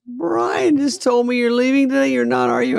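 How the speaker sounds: mother hearing he leaves early, high pleading voice; mom voice. Retold or imitated. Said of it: "she goes".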